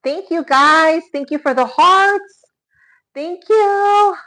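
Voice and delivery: delighted high thanks for the hearts; gushing thanks. Real, not acted. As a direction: gush thanks at the chat.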